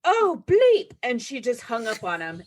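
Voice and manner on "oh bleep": startled curse. Retold or imitated. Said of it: "she was like".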